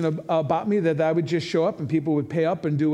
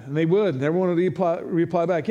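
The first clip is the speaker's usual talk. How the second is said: usual speaking voice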